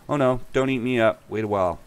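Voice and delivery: pleading goat. A narrator's reading. Second goat begging the troll, high urgent plea.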